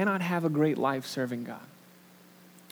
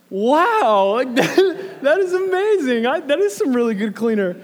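much higher and louder, laughing wow; hammed-up awe at the cleaner; retold amazement at the salesman, high and laughing; mock amazement; 10 semitones higher, 10 dB louder than usual. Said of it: "but my thought was".

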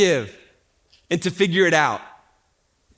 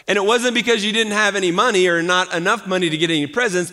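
ordinary delivery

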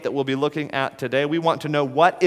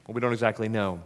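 ordinary talk

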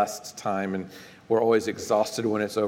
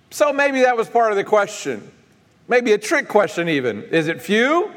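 high, raised preacher's voice; emphatic preaching; 10 semitones higher, 6 dB louder than usual. Real, not acted. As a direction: preach it to the congregation, pitched well up.

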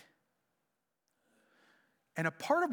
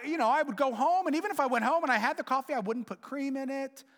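normal talking voice

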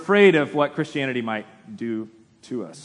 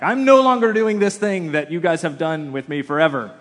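loud, raised-pitch grand announcement; mock bold declaration; 4 semitones higher, 6 dB louder than usual. Son announcing a decision to his parents, mock bold declaration. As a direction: declare it self-importantly, starting high.

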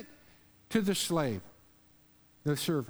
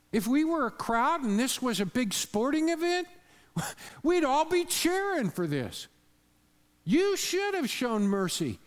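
excited preaching; 7 semitones higher than usual. Real, not acted. Cheering crowd image.

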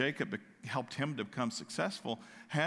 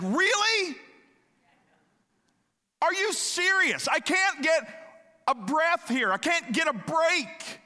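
much higher, louder, fed-up disbelieving cry; exasperated outburst; 8 dB louder, slower than usual. Retold or imitated. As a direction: throw up your hands at one more setback. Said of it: "And you're like".

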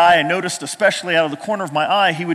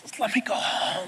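begging; 2 semitones higher, 10 dB quieter than usual. Retold or imitated. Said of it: "I begged you".

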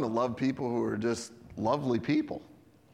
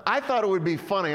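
usual speaking voice

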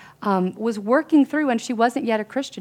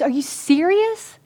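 high, slow incredulous question; acted astonishment, drawn out and raised; retold disbelief; 8 semitones higher, 4 dB louder, slower than usual. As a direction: imitate a stunned friend asking.

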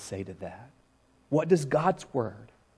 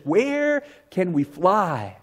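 slow, drawn-out pulpit quote; slow quoted question; quoted preacher line; 6 semitones higher, much slower than usual. Retold or imitated. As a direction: stretch it out slowly like a sermon. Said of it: "he says".

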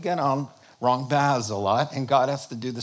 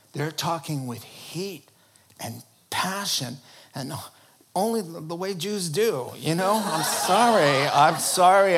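ordinary delivery

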